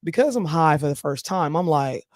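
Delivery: usual speaking voice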